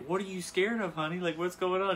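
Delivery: puzzled mom. Retold or imitated. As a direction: imitate a mom questioning her scared child. Mom questioning her frightened son.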